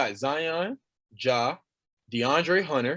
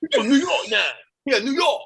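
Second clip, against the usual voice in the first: put-on teasing New York accent, pitched up high; mock New York accent; 7 semitones higher than usual. Podcast hosts roasting a friend, mock New York accent. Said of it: "in their accent".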